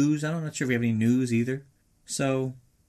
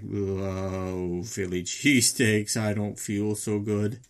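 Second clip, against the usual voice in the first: low, drawn-out queasy groan; slow, low nauseous moaning; queasy inner monologue; 6 semitones lower, much slower than usual. Acted. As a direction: groan it slowly, sick to your stomach. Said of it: "Donnie's inner monologue is like".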